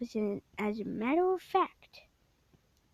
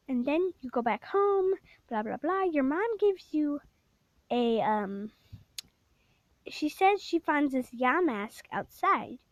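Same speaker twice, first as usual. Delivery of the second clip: ordinary talk, nothing marked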